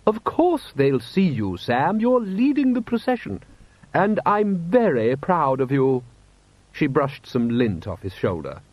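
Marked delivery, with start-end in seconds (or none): 0.0-6.0 high, slow, drawn-out wifely reassurance; Lady Sybil, proud wife. A narrator's reading. Wife reassuring her husband before the procession, proudly. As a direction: encourage him firmly, stretching the key words.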